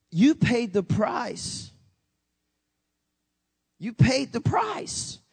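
slow high pleading; pleading insistence. Retold or imitated. Pleading with the Lord.

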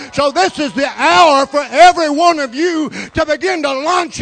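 high, loud, rousing preacher's cry; full-force preaching. Real, not acted. Preacher urging congregation to launch out in faith, full-force preaching. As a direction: shout it out to stir the congregation.

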